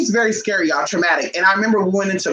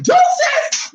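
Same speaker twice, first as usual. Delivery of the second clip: joyful shout; 3 dB louder than usual. Retold or imitated. Shouting goodbye leaving the bus.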